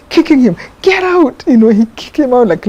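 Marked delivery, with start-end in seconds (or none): 0.8-1.4 a sharp, much higher bark to get out; the jail head ordering the prisoner out in an angry shout. Retold or imitated. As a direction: throw him out with it.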